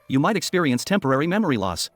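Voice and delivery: usual speaking voice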